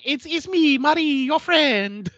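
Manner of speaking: high, slow, sugary voice of the killer; creepy sweet Marie. Retold or imitated. Podcaster voicing Marie cooing to terrified Alex, high and sweet. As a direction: coo it like a friendly psycho. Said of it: "She's like".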